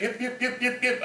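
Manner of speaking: high little dog yips, mimicked; imitating a yip. Park ranger imitating the poodle's yipping bark. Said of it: "more of like a yip type sound".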